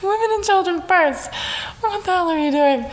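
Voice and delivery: put-on a high, prim "think of the children" wail; prissy suburban mom. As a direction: ham up the scandalized Karen. Continuing the mock prissy voice.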